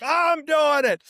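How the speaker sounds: high, loud shout of retold defiance; defiant shout. Boyfriend refusing to leave the team, defiant shout. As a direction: shout her down, stubborn and high-pitched.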